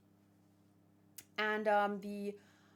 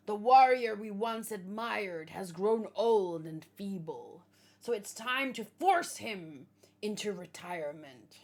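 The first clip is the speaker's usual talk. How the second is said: villainous giant captain; 7 dB louder than usual. Acted. Giant captain announces the overthrow of his chief, loud and menacing. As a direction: proclaim the age of war like a ruthless giant.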